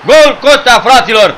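high, loud yells building into a goal call; screamed goal celebration. Real, not acted. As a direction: urge the shot, then roar the goal in triumph. Shooting, then celebrating a goal.

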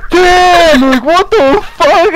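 high, loud, stretched-out disbelief; mock cracking up. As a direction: imitate friends losing it in amazement. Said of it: "they're just cracking the fuck up".

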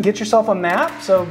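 ordinary delivery

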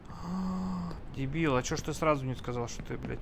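normal talking voice

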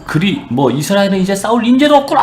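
high, jeering giant's taunt; imitated giant sneering, pitch soaring at the end; mock scorn. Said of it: "하면서 비웃은 거지".

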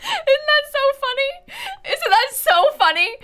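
high, loud squeal through laughter; real laughing fit. Real, not acted. Streamer tickled by the actor's fake accent, laughing hard. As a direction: crack up while talking, loud and high.

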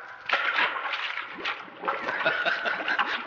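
breathy burst of laughter; hearty laugh. Acted. Cisco laughs at Pancho.

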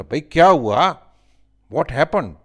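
high, loud question; questioning lion. A narrator's reading. Lion meets the fleeing animals, loud high question. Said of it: "उसने पूछा".

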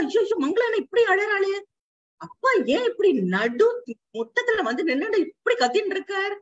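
put-on frightened child calling out in distress.

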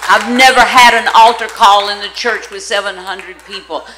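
slow, amazed, bursting with delighted laughter; excited laughing exclaim. Real, not acted. Preacher to her congregation, recalling the crowd, loud laughing amazement. Preacher marvels at huge altar response.

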